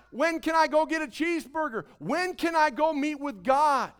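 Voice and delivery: loud and high, driving contrast; fervent preaching. Real, not acted. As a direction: preach it with full fire. Contrast mock cheeseburger line.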